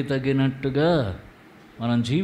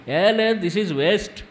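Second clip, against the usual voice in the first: a touch of a loud careless driver, much higher; dismissive driver; 7 semitones higher, 4 dB louder, much slower than usual. Retold or imitated. Driver in America waving off the policeman, mock careless. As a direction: toss it off like a careless tourist.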